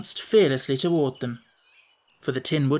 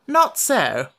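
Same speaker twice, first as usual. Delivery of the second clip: stiff, higher and louder retort; stiffly; 6 semitones higher, 5 dB louder than usual. Acted. Wogglebug to Tip, stiff and offended. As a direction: snap it back stiffly, offended. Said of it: "rejoined the Wogglebug stiffly".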